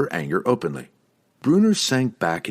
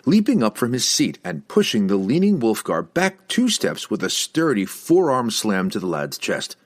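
ordinary delivery, nothing marked

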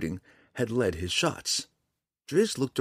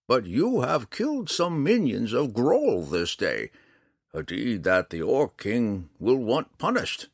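put-on old man voice; 7 semitones higher, 4 dB louder than usual. A narrator's reading. Old man Montaleo speaks.

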